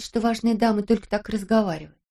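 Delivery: usual speaking voice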